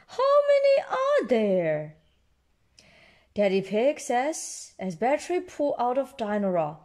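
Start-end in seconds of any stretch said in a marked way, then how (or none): 0.1-2.0 high-pitched amazement; high storybook astonishment; surprised question. A narrator's reading. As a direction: ask it high, in exaggerated disbelief. Surprised at batteries running out.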